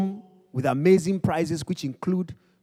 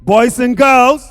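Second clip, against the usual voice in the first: booming, much higher and louder announcer; ringmaster; 8 semitones higher, 4 dB louder than usual. Acted. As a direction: build it up and roar the winner's name. Announces winner of the contest.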